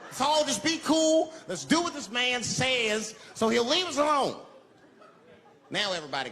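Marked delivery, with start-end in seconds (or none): 0.0-4.3 raised, high-pitched appeal; trying to keep cool. Retold or imitated. Bus rider urges passengers to obey the man, high appeal. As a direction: plead with the crowd to cooperate. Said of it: "I can't lose my cool".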